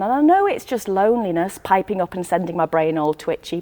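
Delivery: plain reading voice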